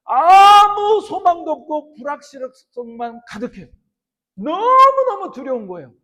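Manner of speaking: very loud, high-pitched sermon delivery; impassioned preaching. Acted. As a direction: thunder out God's promise to the congregation. Preaches at full force. (목청껏 외치는 뜨거운 설교)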